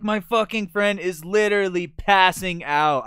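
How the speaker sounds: loud, high, heated protest; indignant retold outburst. Friend argues with stingy stranger, indignant outburst. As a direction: raise your voice in exasperated urgency, retelling.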